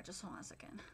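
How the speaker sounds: muttered under breath while checking; whispered aside. Real, not acted. Reviewer whispering to himself while scrubbing through the episode. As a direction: whisper it to yourself.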